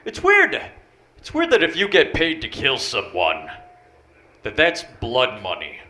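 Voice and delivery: ordinary delivery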